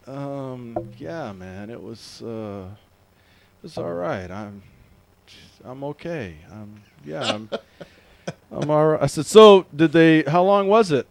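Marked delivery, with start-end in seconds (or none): 0.1-9.0 low, very soft, halting stoner voice; stoned friend. Retold or imitated. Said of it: "you know the stoner, the, the friend". 9.3-11.1 loud phone questioning. Retold or imitated. Questioning a groggy friend, retold.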